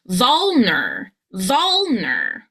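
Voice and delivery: "vulner" slow, with VUL stretched and swooping; stress on first syllable. Shown as a demonstration. Coach joins two syllables, slowly stressing the first. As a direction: stretch VUL, then drop onto "ner" in "vulner". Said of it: "you want your voice to go higher, louder, and longer".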